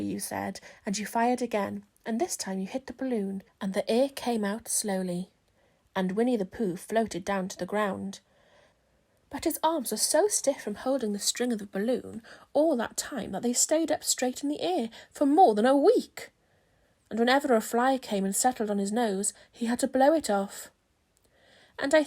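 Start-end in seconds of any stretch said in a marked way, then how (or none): none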